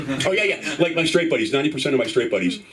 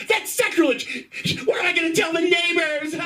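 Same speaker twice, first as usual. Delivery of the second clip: very high shouted mom impression; hammed-up hysterical outrage; outraged mother. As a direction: shriek in mock horror as a scandalized mother. Outraged mother wails to dad.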